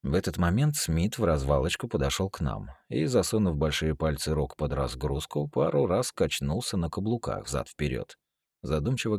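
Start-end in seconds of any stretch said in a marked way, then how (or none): none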